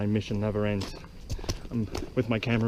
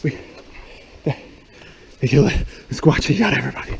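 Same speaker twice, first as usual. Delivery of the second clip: panicked, breathless and loud; being chased; 5 semitones higher, 8 dB louder, faster than usual. Acted. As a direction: scream while running for your life. Fleeing the Squatch in panic.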